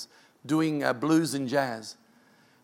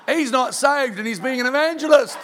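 laughing; 7 semitones higher, 6 dB louder than usual. Real, not acted. Amused aside about unsaved evangelist.

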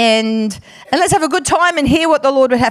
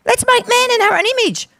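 higher, quicker repeat of God's words; 9 semitones higher, faster than usual. Acted. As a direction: lift it higher and quicker the second time. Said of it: "Father God says to the Son".

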